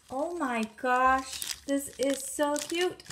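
usual speaking voice

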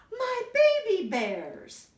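high, loud and slow; delighted high Mommy. Acted. Mommy Bear finding her cubs, high surprised delight. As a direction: cry it out in happy surprise.

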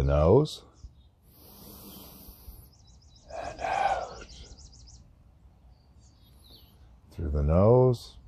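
slow, low breathing-guide voice; guided meditation. Acted. Meditator guiding listeners through cleansing breaths, slow guided meditation. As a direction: pace each cue with the breath, letting "out" go on the exhale.

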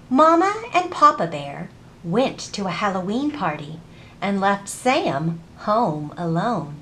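normal talking voice

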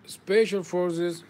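ordinary delivery

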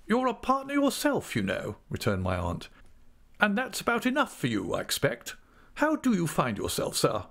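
high-pitched, curt aunt; extraordinary curtness. A narrator's reading. Aunt puts the clerk in his place, curtly. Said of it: "addressed to him with extraordinary curtness".

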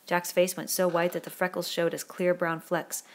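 ordinary delivery

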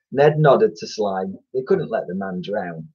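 usual speaking voice

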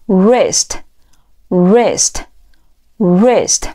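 "wrist" starting on the R, W silent. Shown as a demonstration. Teacher drilling a word for learners, "wrist" with silent W. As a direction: say "wrist" slowly as "rist", with pauses between. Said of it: "W是不发音的".

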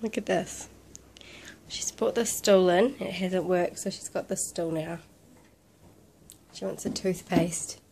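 low, very soft near-whisper; hushed. Real, not acted. Quietly filming toddler up to mischief.

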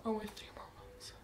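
hushed, breath-only whisper; amazed whisper. Real, not acted. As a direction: whisper it as the news sinks in. Realises break is three months away.

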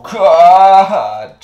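pained groan. Real, not acted. Hurt, paint everywhere.

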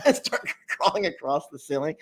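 normal talking voice

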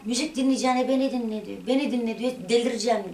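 mock angry mother. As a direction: replay mother's insistent shouting, half-acted. Quoting the mother during the fight. (annenin bağırışını taklit ederek)

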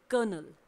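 कर्नल said as "kənəl", r silent; कर्नल spoken clearly with no r; say colonel. Shown as a demonstration. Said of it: "It is". (कर्नल, आर की आवाज़ के बिना)

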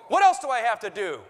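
hammed-up angry alarm-clock voice; mock scolding shout. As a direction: bark at a lazy sleeper like a drill sergeant. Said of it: "wake you up in the morning saying".

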